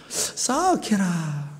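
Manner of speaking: slow, drawn-out Gyeongsang 싸악해라 with pity; Gyeongsang dialect pity. Shown as a demonstration. Preacher repeating the Gyeongsang pity word, slow Gyeongsang sigh. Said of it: "이 방언이 좀 잘 알아들으세요".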